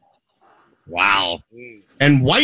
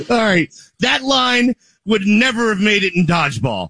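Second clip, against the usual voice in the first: ordinary talk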